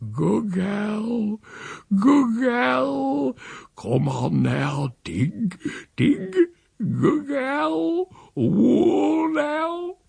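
high, drawn-out coaxing croon; thick, tender voice. A narrator's reading. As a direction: soothe an animal with slow, thick words. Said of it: "muttering all the time in his thick, tender voice".

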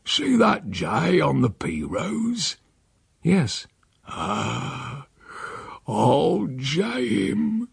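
put-on slow, cunning old man's voice; sly old uncle. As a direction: savour the scheme, drawn out. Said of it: "faint curve of the old cunning".

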